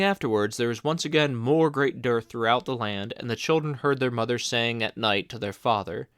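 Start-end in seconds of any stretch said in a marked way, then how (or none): none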